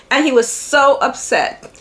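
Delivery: usual speaking voice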